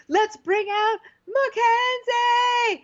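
big booming high host shout; host announcement. Retold or imitated. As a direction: bellow it like a game show reveal. Host announcing daughter reveal.

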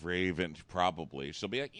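ordinary delivery

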